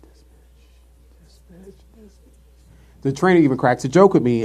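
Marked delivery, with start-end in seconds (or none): 0.0-3.0 whispered, slow and seething; under-breath whisper. Retold or imitated. Sitting in training, seething in a whisper. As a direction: mutter it silently to yourself. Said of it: "I'm just sitting there like".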